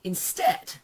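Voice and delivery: the voice breaks up into squeak; voice cracking. Real, not acted. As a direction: voice cracks high on "Instead". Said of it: "have you heard my voice? It's knackered".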